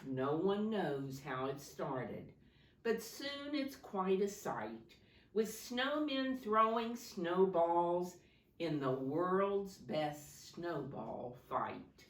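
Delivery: rhythmic picture-book read-aloud; reading to children. Acted. Teacher reading a snow story to her class, lilting. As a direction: lilt the rhyming verse for the class.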